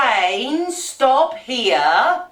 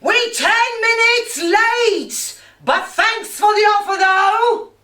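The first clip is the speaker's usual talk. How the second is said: shouted reply; 8 semitones higher than usual. Retold or imitated. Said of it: "He shouted back".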